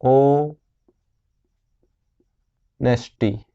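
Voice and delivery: ओ and नेस्टी said apart with a pause; split syllables. Shown as a demonstration. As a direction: break ऑनेस्टी into ओ then नेस्टी, silent h. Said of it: "ऑनेस्टी को कैसे pronounce किया जाता है". (ऑनेस्टी को ओ... नेस्टी तोड़कर बोलना)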